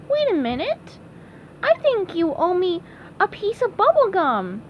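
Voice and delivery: high cartoon voice; puzzled SpongeBob. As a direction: pipe up high as you recall something.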